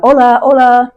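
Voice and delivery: calls out entering the room; sing-song housekeeper call. Retold or imitated. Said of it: "she opened up".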